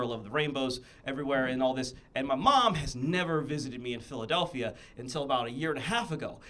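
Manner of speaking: ordinary delivery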